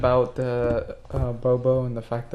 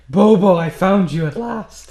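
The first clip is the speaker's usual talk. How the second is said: dramatic reverent; 6 semitones higher, 8 dB louder than usual. Acted. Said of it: "I'm gonna grab it and be like".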